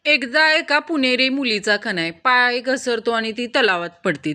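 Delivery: ordinary delivery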